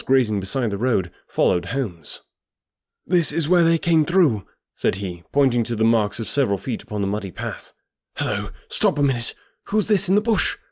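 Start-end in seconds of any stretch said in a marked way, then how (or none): 3.1-4.5 urgent. A narrator's reading. Stranger hot on the trail. 8.2-10.6 startled. A narrator's reading. Startled find during the chase.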